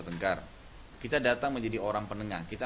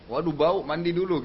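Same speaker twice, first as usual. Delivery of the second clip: louder, higher, teasingly blunt; mock blunt complaint; 7 semitones higher, 7 dB louder than usual. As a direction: act out a rude husband wrinkling his nose. Contoh suami menolak istri kasar.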